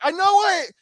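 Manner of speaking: high, loud mock-desperate yell; exasperated shout. Announcer answering a heckler, exasperated mock shout. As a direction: yell back at the heckler, hammed-up.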